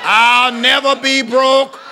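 high, loud revival preaching with stretched-out phrases; full-force preaching. Real, not acted. Preacher leading a congregation to answer the Lord, exultant shout. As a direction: declare it in triumph, letting each phrase ring.

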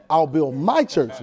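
slow phrase with weight on "my"; deliberate, stretched emphasis on "my"; stress on "my". Shown as a demonstration. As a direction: lean on "my" to claim the church. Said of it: "Not a church".

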